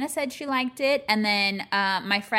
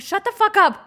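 higher, louder mock outburst; 9 semitones higher, 7 dB louder than usual. Telling husband to stop talking about their son growing up, mock outburst. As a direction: snap back in joking outrage. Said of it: "I'm like".

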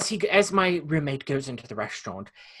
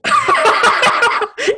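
long high cackling laugh; real burst of laughter at the embarrassment; shrieking laugh, loud and high; 11 dB louder than usual. Real, not acted. Laughs as game is up.

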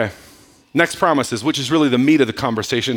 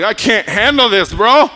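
freaking out; 5 semitones higher, 5 dB louder than usual. Retold or imitated. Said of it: "He freaked out and was like".

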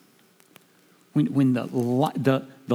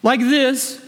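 much higher and louder, impassioned preacher; full-force preaching; 12 semitones higher, 4 dB louder than usual. Acted. Preaching climax.

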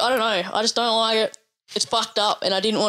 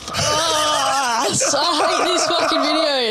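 half laughing, half grossed out, higher; disgusted laugh; 7 semitones higher, much slower than usual. Real, not acted. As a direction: laugh through disgust at the video.

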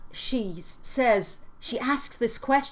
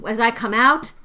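louder, rising on out; tried reading; 3 semitones higher, 9 dB louder than usual. Shown as a demonstration. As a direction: test the line aloud. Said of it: "that's a weird thing".

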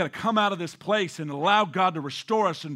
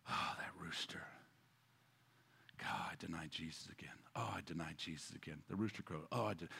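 voiceless, much softer regret; remorseful whisper; 14 semitones lower, 21 dB quieter than usual. Acted. Peter lamenting his denial each morning, whispered. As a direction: whisper it like guilt at dawn.

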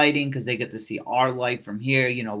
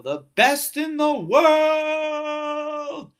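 much higher, slow, drawn-out announcer call; ring announcer impression; 4 dB louder, much slower than usual. Podcaster imitating the old announcer's tournament call. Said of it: "I'm not going on 'cause I can't do it".

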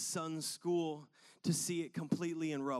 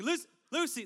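higher, louder call of the name; 10 semitones higher, 5 dB louder, faster than usual. Retold or imitated. Dad calling louder for his daughter at the park, higher and louder. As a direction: call Lucy higher to get her attention. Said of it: "And I'm like".

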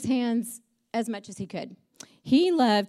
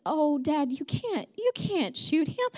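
pitched-up, dismayed protest, slowing at the end; upset pleading; 6 semitones higher, slower than usual. Retold or imitated. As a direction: replay a daughter begging her father. Acts out child begging Dad.

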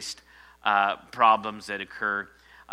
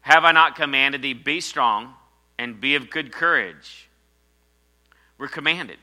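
loud, higher preaching of the command; proclaiming scripture; 4 semitones higher, 10 dB louder than usual. Acted. Pastor shouting God's command to be strong, loud proclamation. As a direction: call it out like a command from heaven.

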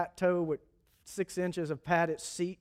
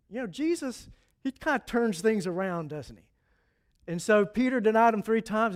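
ordinary talk, nothing marked

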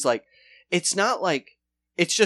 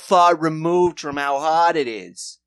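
louder protesting lazy cop; mock whiny cops; 8 dB louder than usual. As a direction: push back like a put-upon night-shift cop. Beat cops whining back.

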